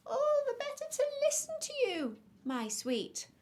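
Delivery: very high, loud fake granny voice; wolf pretending Granny. A narrator's reading. Wolf fools the girl from the bed, high granny put-on. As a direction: falsetto old-lady cooing. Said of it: "pretending to be Granny".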